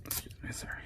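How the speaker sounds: whispered apology; whispering. Real, not acted. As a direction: whisper it aside, off-mic. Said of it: "I'm burping".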